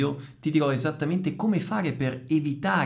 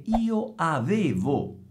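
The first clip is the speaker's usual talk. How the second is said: "io avevo" slow, rising on "io" with the final o clear; slow correction; 5 semitones higher, slower than usual. Shown as a demonstration. Teacher correcting "aveva" to "avevo", slow and clear. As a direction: slowly stress the o of "avevo". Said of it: "io aveva".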